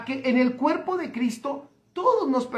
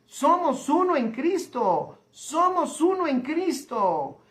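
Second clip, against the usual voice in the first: slow emphatic declaration; slower than usual. Acted.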